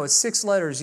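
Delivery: ordinary talk, nothing marked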